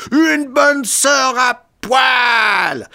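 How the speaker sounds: high, very drawn-out shout; howling. A narrator's reading. Lecturer howls a last provocation before the knockout, high and slow. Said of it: "hurla Kanonov en essayant".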